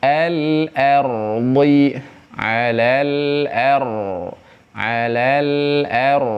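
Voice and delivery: slow "alal ar" with a heavy r; slow tajwid chant with a heavy r; thick r recitation. Shown as a demonstration.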